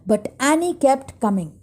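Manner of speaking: plain reading voice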